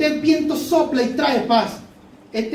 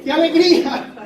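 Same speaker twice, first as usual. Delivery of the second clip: joyful laughing praise; 3 semitones higher than usual. Real, not acted. Preacher to congregation, rejoicing at the rescue: laughing praise. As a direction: laugh for joy as the rescue comes.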